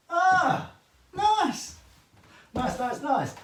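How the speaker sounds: high, delighted nice. Real, not acted. Teacher delighted with the step, squealing high. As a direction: squeal of pleasure.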